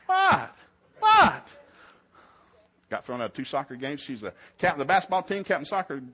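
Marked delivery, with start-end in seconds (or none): 0.1-1.5 much higher, louder innocent what; Corey acting innocent to the referee, hammed-up; mock innocent protest. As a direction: play dumb, squeaky and indignant.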